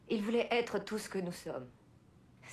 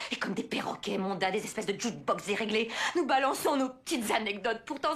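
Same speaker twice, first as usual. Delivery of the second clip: normal talking voice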